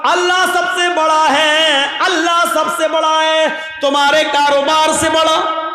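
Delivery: high, chanted calling-out on held notes; full-force preaching. Real, not acted. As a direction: summon distant crowds, stretching phrase endings. (बुलंद आवाज़ में पुकारती तक़रीर)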